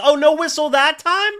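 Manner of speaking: a real sarcastic shout at the refs; sarcastic outrage. Real, not acted. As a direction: jeer at them with biting sarcasm. Sarcastic jab at the referees.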